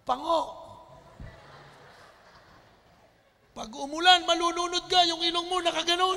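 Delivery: high, loud singsong teasing; boy teasing his rival's flat nose, mocking; mocking taunt. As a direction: sneer at his nose like a schoolyard bully.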